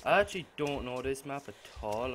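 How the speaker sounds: ordinary talk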